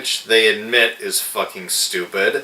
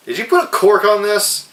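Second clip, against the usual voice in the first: high-pitched, incredulous question; mock indignant surprise; 11 semitones higher, faster than usual. Reviewer imitating Chester's disbelief at the flat champagne, high and incredulous. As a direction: act outraged that the drink went flat. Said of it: "Chester is like".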